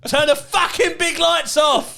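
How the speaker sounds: high, slow Cockney bellow; angry Cockney. Retold or imitated. Imitating dad yelling about the lights, angry Cockney. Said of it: "I love Cockney accents".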